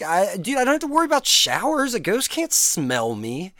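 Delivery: ordinary talk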